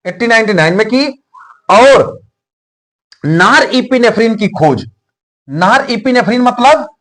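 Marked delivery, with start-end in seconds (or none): none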